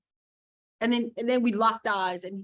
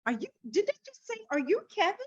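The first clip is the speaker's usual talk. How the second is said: shocked disbelief; 11 semitones higher, faster than usual. Retold or imitated. Retelling her shocked reaction.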